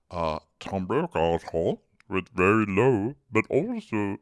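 slow newsman gravitas; lightly voiced Tom Brokaw. Imitating Harry doing Tom Brokaw. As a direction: intone it like a news anchor. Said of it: "He sounded a lot like him".

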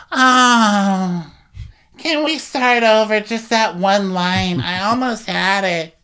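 high, drawn-out mock groan; Whitney. Mimicking Whitney fluffing her line, high whiny plea. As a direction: plead for a retake, slow and high. Mock flubbed confessional take.

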